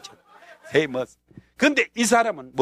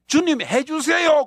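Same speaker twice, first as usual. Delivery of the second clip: urgent prayer; 11 semitones higher, 3 dB louder, slower than usual. Retold or imitated.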